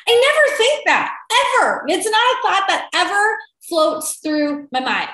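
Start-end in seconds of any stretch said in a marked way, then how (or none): 0.1-1.8 "never" punched much higher and louder; stress on never. Acted. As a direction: slam the word "never" in "I never think that". Insisting she never thinks that.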